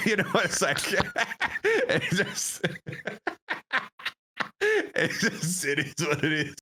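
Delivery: words broken up by high laughter; laughing. Real, not acted. Laughing while telling story.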